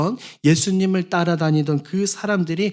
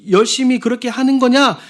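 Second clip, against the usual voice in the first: scornful nagging; 7 semitones higher than usual. Retold or imitated. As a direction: scoff at someone too eager at church. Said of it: "이런 소리들 들으신 경험들".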